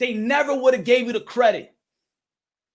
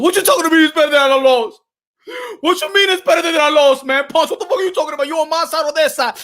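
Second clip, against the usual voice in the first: angry Wilder; 3 semitones higher, 3 dB louder, faster than usual. Acted. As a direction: fire back, offended.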